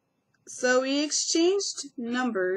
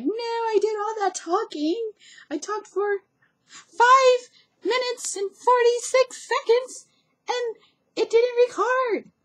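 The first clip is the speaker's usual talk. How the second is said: high, loud, drawn-out whine; exasperated complaint; 13 semitones higher, 8 dB louder than usual. Retold or imitated. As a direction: whine it out in mock dismay, stretching the words. Retelling own frustrated outburst.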